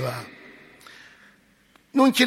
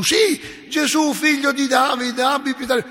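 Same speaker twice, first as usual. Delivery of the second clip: high, loud desperate plea; crying out for mercy; 8 semitones higher, 6 dB louder than usual. Retold or imitated. Blind beggar shouting to Jesus over the crowd, high and loud. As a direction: call out over the crowd, higher and louder. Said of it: "urlava ancora più forte".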